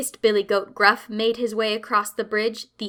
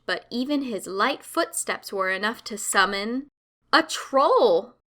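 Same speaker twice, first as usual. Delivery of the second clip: ordinary delivery, nothing marked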